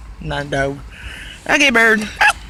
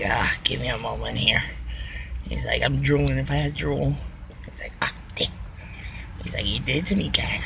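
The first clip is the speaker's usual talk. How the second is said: whispering; 11 semitones lower, 12 dB quieter than usual. Retold or imitated. Voicing the bird quietly.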